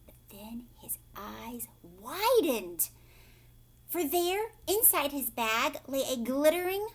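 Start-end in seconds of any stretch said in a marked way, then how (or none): none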